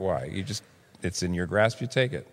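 usual speaking voice

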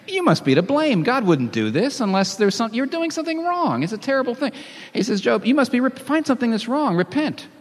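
high-pitched, urgent finger-pointing; insistent accusation. Acted. Acts out Eliphaz accusing Job.

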